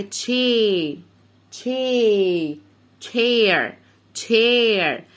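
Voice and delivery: slow modelling of "تشير" with a held vowel; sounding out. Shown as a demonstration. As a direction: stretch "تشي" long, then close it into "تشير". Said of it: "قول معايا".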